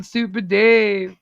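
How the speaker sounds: usual speaking voice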